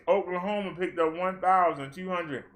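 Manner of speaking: ordinary talk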